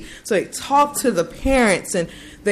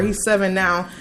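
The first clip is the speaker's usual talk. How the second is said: ordinary talk, nothing marked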